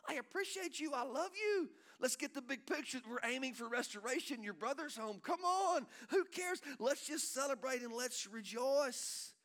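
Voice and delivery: high-pitched, rousing plea to a son; impassioned fatherly appeal, voice lifted high; exuberant father. Acted. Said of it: "He said to the older son".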